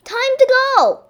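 very high, loud announcing call; crying out. A narrator's reading. Mister Duck rousing the family to leave, crying out. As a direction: sing it out loudly to the whole family. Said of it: "he cried".

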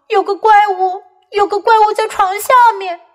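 put-on high, upset child voice; mock sobbing. Kipper reporting the monster to his parents, mock sobbing. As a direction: report it tearfully, lightly acted. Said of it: "他哭泣着说".

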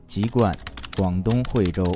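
ordinary delivery

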